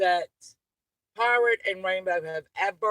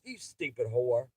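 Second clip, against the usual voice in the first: usual speaking voice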